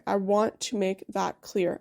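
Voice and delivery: ordinary delivery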